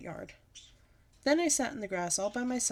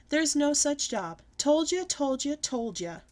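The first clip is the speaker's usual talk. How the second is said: taunt; 7 semitones higher, 6 dB louder, slower than usual. A narrator's reading. Bully taunting again.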